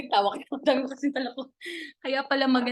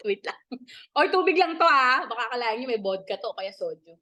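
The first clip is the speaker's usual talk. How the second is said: ordinary talk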